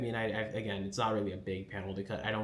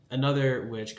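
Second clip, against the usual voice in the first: ordinary delivery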